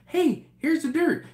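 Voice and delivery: high, loud mock delight at spotting something; mock excited. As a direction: pipe up eagerly, hamming up your own foolishness. Acting out his own target fixation.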